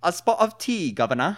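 high, loud mock-British offer; bad British accent. Offering tea in jest, hammed-up British accent. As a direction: put on a silly British tea-time voice. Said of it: "try to talk in British accents".

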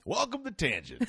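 high announcer voice opening the show; bright host voice welcoming listeners; podcast host intro. Pretend. Host mock-opening the show for listeners, high announcer voice.

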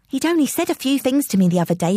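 usual speaking voice

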